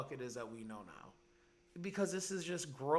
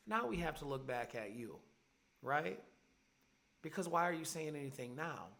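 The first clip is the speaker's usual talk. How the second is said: usual speaking voice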